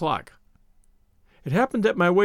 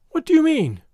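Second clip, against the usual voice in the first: high, loud startled cry; cried out; 9 semitones higher, 5 dB louder than usual. A narrator's reading. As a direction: she cries it out, caught off guard. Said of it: "she cried".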